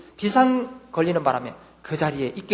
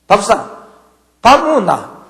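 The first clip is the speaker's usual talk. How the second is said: forceful shout; 2 semitones higher, 10 dB louder, slower than usual. Retold or imitated. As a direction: burst it out far too loud. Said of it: "힘있게 외쳤어요".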